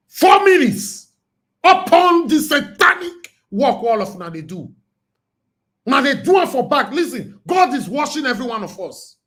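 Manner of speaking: loud and high, preaching hard at the listeners; fired-up preaching. Real, not acted. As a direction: hammer the warning home like a sermon. Rant against deceivers, God watching.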